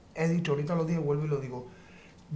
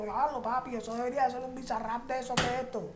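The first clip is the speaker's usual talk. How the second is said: lightly voiced friend, higher and amazed; hyped-up friend; 9 semitones higher than usual. A friend hearing the idea, a lightly voiced amazed buddy. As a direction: toss it off like a buddy who's impressed.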